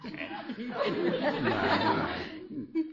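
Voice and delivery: high awkward chuckle; embarrassed laugh. Acted. Embarrassed laugh after "Dad.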